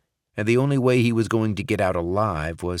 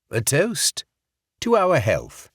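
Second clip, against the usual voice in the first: higher, hearty fake English accent; fake English accent toast; 8 semitones higher, 4 dB louder, slower than usual. Barrett toasting Sokolov in fake English accent. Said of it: "in his fake English accent".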